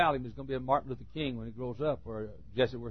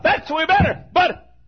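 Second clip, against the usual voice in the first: preaching at full force; 14 dB louder than usual. Acted. Hymn shouted at full force.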